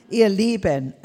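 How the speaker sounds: ordinary delivery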